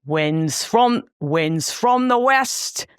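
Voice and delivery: a poetic line in a lightly put-on Liverpool lilt, slowed; half-hearted stab at Scouse; mock Scouse accent. Co-host dares her to demo Scouse, lightly Liverpool-accented. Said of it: "Do you wanna demonstrate for us now".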